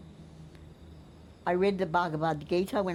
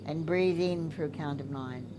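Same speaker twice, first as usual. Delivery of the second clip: soft spa whisper; 4 dB quieter than usual. Shown as a demonstration. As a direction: count the breaths gently and evenly. Leads a breathing exercise.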